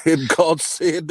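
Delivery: high, slow preacher intonation. Pretend. Preacher voice again.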